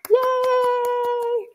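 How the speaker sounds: high squeal of joy; squealed yay. Real, not acted. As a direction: cheer for the winner. Celebrating winner on the call.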